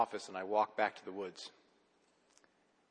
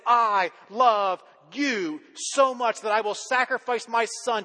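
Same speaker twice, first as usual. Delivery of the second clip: loud, high, driving sermon voice; preaching at full force; 10 semitones higher, 9 dB louder than usual. Acted.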